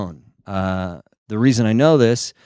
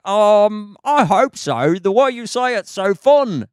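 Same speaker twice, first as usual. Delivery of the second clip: high, put-on London accent; mimicked London accent; 11 semitones higher, slower than usual. Comedian replying to London girls, parroting their London accent high. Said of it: "Do an impression of them".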